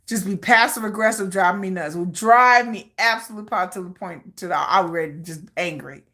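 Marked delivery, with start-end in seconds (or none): none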